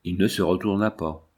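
ordinary delivery, nothing marked

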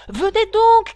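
loud high urgent call; shouting. Acted. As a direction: hurry her along with a shout. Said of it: "cria le griffon".

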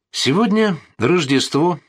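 high, slow and ceremonious; solemn announcement. Acted. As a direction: proclaim it like a grand toast. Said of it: "торжественно заявил полковник".

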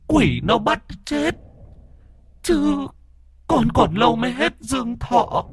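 put-on a scared dead girl warning of the demon; ghost girl's voice. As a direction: beg the master in a thin high child's voice. Ghost girl speaks through body.

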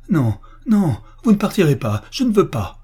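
high, forceful, pleading protest; desperate refusal. Acted. Woman holding back her departing lover, desperate refusal. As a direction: beg and refuse at once. Woman begs officer not to leave.

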